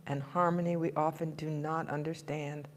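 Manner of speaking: quiet, low prayerful closing; soft prayer close. Acted. Minister closing the meditation, soft and prayerful. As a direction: ease the words down gently like a prayer.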